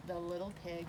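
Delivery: ordinary delivery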